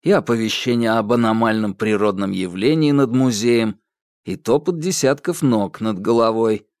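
plain reading voice

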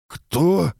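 high, choked little question; half-strangled. A narrator's reading. Second mage is shaken by the stranger, half-strangled voice. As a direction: squeeze it out, high and half-stifled. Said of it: "каким-то полузадушенным голосом спросил он".

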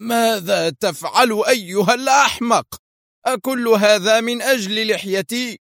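furious high-pitched cry; angry shout. A narrator's reading. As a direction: snap at the fool in pain and fury. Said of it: "وصاح في غضب".

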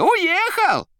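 high, loud rattling cry; shouting. A narrator's reading. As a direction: shout it down the line. Koroviev on phone.

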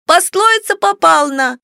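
put-on high small child, mispronouncing R as L; lisping little girl. A narrator's reading. Little sister prompts the puzzled parents, high childish lisp. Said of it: "неожиданно подсказала Картавя маленькая сестра".

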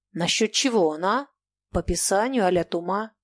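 ordinary delivery, nothing marked

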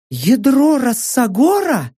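slow, high-pitched amazed question; astonished. A narrator's reading. Saka reacting to narrator's theory, high astonishment. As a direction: voice a woman stunned by the discovery. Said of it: "поразилась Сака".

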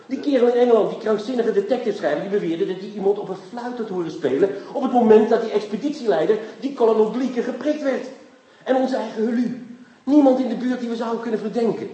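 eager detective cracking the case; excited. Acted. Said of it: "Wat doe je opgewonden?".

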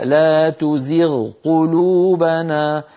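لا تزغ قلوبنا with the ghayn clearly sounded before the qaf, very slow; slow clear recitation. Shown as a demonstration. Teacher inviting students to try the verse, very slow recitation. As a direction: recite it very slowly so the ghayn stands apart. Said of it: "جربوا هكذا".

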